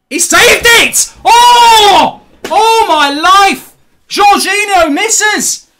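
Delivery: high, loud celebration, vowels stretched out; real ecstatic shout. Real, not acted. Fan reacting to a penalty miss that puts England back in, ecstatic shout. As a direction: yell it out as hope suddenly returns.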